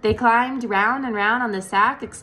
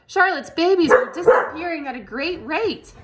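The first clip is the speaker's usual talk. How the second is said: frantic; 8 semitones higher, 5 dB louder than usual. A narrator's reading. Narration of frantic Wilbur.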